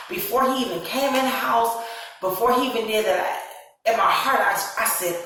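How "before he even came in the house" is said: ordinary talk, nothing marked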